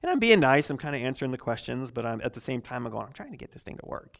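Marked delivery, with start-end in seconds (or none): 3.0-4.2 fast, frustrated whisper; whispered aside. Retold or imitated. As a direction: mutter it so the old man won't hear. Frustrated aside while being nice.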